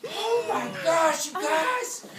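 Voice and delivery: ordinary delivery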